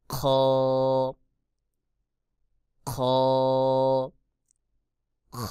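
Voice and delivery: খ as a long throat-scraped "kho"; letter demonstration. Shown as a demonstration. Teacher shows Arabic kha, খ rasped and drawn out. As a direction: scrape খ from the far end of the throat, hold it. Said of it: "কণ্ঠনালীর শেষ ভাগ হইতে".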